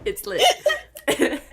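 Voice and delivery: giggling through lit; laughing out It's lit. Retold or imitated. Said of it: "you said lit".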